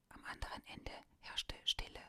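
ordinary delivery

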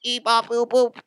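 high monotone robotic wail; wailing robot meter maid. Acted. Robot meter maid watching a robot driver get shot, wailing. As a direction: cry out on one long high note, mechanically. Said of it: "a robotic meter maid".

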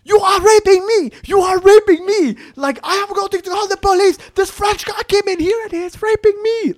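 very high, loud, hammed-up woman's cries; acted hysterical shouting for the police; mock screaming accusation. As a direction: cry out false alarm in a put-on African accent. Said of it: "she's like".

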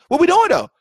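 mock challenge. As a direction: call them out.

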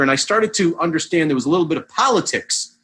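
usual speaking voice

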